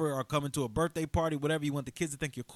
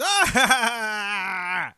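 loud, high burst of imitated laughing; mock crowd laughter; 8 semitones higher, 9 dB louder than usual. Speaker showing how kids and adults reacted to the joke, mock roaring laughter. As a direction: crack up like a whole audience.

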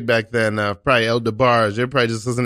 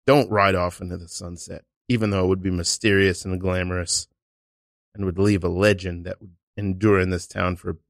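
lower-pitched, solemn dramatic appeal; earnest plea; 5 semitones lower than usual. Acted. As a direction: implore a departing hero with hushed gravity. Improv dramatic plea to departing hero.

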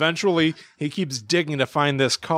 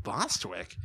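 low, soft puzzled echo; much lower, quieter query; baffled grandpa; 6 semitones lower, 11 dB quieter than usual. Retold or imitated. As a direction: mutter the odd name in bewilderment.

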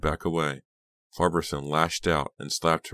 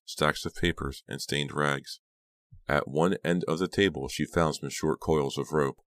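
usual speaking voice

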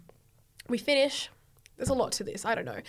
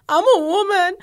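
higher, louder teary wail; mock crying; 7 semitones higher, 10 dB louder than usual. Daughter telling dad on the phone, mock crying. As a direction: sob it to dad. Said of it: "I'm, like, crying".

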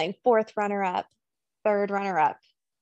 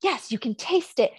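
higher, louder, pumped-up coaching voice; pageant coach mode; 5 semitones higher, 4 dB louder than usual. Retold or imitated. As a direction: hype her up like a pageant coach. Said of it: "he's in pageant coach mode".

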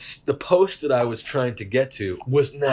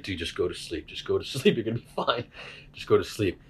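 calm soothing friend; 4 semitones lower, 7 dB quieter than usual. Retold or imitated. Friend calming panicking stoner.

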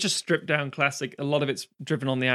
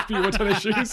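laughing repeat; 7 semitones higher than usual. Real, not acted. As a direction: repeat the title cracking up at the joke.

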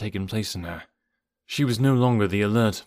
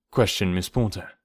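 ordinary delivery, nothing marked

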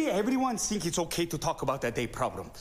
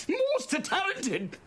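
much higher, slow and drawn-out; soaring praise; much slower than usual. Acted. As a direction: stretch it up high with pride. Father praises daughter, scolding ex.

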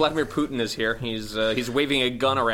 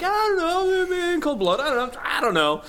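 much higher, loud dopey mumble; mock clueless high voice; 3 dB louder than usual. Suggesting his friend play Capote as a clueless dude, high and dopey. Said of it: "played it like you".

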